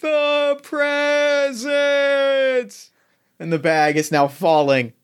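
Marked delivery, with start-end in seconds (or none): 0.0-2.9 high, drawn-out wail of despair; Santa cry. Acted. Santa cries out.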